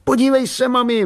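put-on high boyish call to mum. A narrator's reading. Boy calling his mother to watch, calling out.

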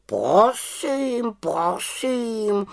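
high whispered huffy croak; a touch of hurt pride; offended raven. Acted. Raven takes offence at the witch, high and huffy. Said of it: "urazil se Abraxas".

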